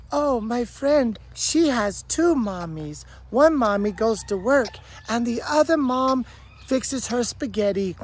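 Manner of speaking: mock the sweet innocent cartoon friend; mimicking the cartoon. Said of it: "Peppa Pig's best friend".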